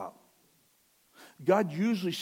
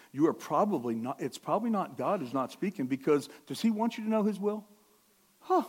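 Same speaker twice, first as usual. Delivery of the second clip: ordinary talk, nothing marked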